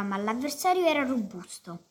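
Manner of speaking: plain reading voice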